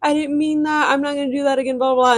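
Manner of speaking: a hammed-up tearful apology; mock crying plea. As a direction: mimic his waterworks, pleading no no no. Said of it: "start crying, a whole waterworks".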